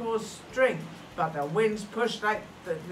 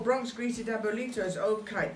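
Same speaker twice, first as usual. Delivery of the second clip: plain reading voice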